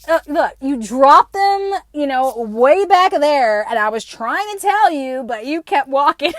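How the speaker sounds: patient stranger. Retold or imitated. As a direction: spell it out slowly like a patient helper. Homeless man explains he tried.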